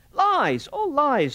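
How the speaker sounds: high, loud, desperate denial; desperate cry. Acted. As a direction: cry out in panic. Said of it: "said Morris desperately".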